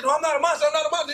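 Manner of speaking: high-pitched mock protest; acted-out anxious denial; retold pleading. Retells woman pleading at gunpoint.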